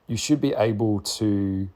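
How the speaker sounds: ordinary talk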